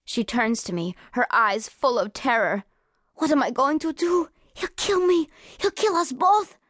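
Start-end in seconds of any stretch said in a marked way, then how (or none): 3.2-6.6 higher, panicky pleading; she's sure he'll kill her; frightened girl. A narrator's reading. Terrified girl fears the man.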